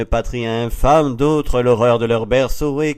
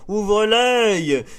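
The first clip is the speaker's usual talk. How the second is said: high, sweeping lookout's cry; ringing deck call; 10 semitones higher, 4 dB louder than usual. A narrator's reading. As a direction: call out a warning to the ship's crew. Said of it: "Une voix retentit sur le pont".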